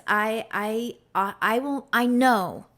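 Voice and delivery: stumbling over words; stuttering, tripping over each word. Real, not acted. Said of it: "I'm stuttering over my words".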